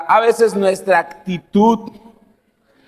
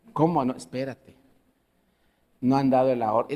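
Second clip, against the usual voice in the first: softer, discreet correction; hushed; 8 semitones lower, 8 dB quieter than usual. Retold or imitated. Table neighbour hushing him. (susurro discreto)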